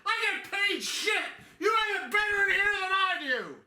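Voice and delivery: angry yelling guard. Acted. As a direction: roar it back at the prisoner. Acted guard yelling at inmate.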